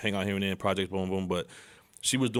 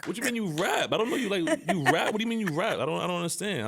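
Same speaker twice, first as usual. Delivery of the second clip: high, mock-baffled rant; stunned disbelief; 9 semitones higher than usual. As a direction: play it like you can't believe it. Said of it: "I'm like".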